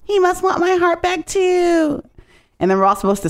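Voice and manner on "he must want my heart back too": much higher, slow, dreamy mock swoon; high drawn-out mock swoon; swooning mock girl. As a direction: gush it like a lovestruck girl, drawn out. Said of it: "then she's like".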